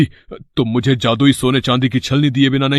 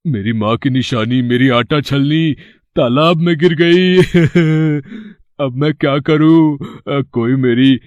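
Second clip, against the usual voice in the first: hammed-up tearful lament for sympathy; रोने का नाटक. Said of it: "रोने का नाटक करता है". (बनावटी रोना, खिंचे हुए शब्द)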